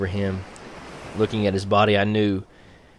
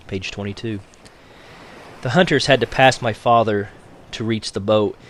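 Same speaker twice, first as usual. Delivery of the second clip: ordinary delivery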